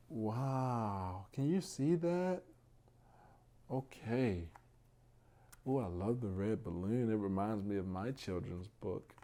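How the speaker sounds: usual speaking voice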